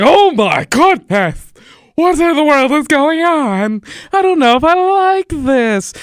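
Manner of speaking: high, loud mock-scandalized pearl clutching; hammed-up horrified older lady; scandalized prude. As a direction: play a shocked churchgoer, voice up high. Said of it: "more religious ... they're just like".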